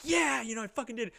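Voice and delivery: triumphant. Retold or imitated. Boy right after his first kiss, triumphant. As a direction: burst out high, celebrating.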